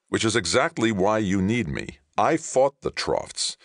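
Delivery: high, emphatic comeback; forceful retort. Acted. Johnny insists Ray needs him, forceful.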